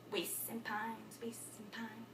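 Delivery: very soft and slow, sinking; hushed, trailing declamation; fading away. Acted. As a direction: let it waste away to almost nothing. Acted monologue dies away.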